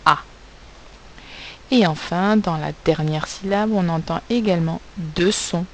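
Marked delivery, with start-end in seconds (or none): none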